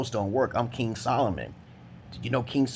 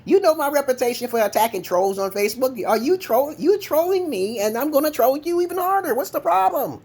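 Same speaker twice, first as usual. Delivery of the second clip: loud, squealing taunts, pitched way up; high mocking rant; 13 semitones higher, 5 dB louder than usual. Telling the troll he will be trolled harder, high mocking rant. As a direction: jeer at him in a high, worked-up voice.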